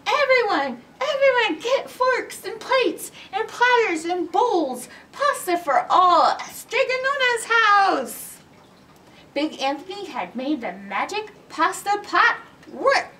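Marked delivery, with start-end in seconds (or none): 0.1-8.4 high, loud call to the whole square; shouted. A narrator's reading. Big Anthony calls the townspeople from the fountain, high loud shout. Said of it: "jumped on the fountain and shouted".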